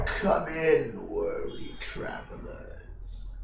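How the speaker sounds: put-on slow, hushed old traveler; weary old host. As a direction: beckon softly as a mysterious old man.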